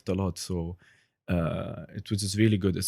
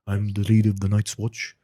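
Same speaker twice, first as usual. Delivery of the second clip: louder, solemn Jon Snow voice; Jon Snow impression; 5 dB louder than usual. Shown as a demonstration. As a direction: proclaim it like the Night's Watch commander. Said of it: "for those Game of Thrones fans".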